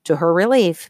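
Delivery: ordinary delivery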